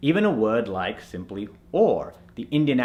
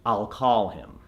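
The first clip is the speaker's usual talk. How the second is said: American accent; 3 semitones lower, 4 dB quieter, slower than usual. Shown as a demonstration. Coach shows what to say, American accent. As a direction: call with a long open "ah". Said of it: "so you want to say".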